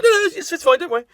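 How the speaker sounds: high, loud breezy posh English brush-off; posh English bloke. Retold or imitated. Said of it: "some, like, posh English bloke".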